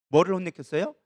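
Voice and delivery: normal talking voice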